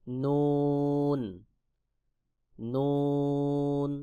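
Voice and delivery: নুন held very long with a humming nasal; drawn-out letter demo. Shown as a demonstration. Teacher showing students the letter নুন. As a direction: stretch out নুন slowly, Quran style. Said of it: "যেমন".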